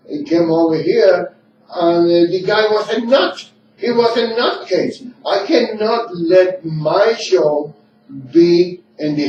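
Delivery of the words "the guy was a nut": ordinary talk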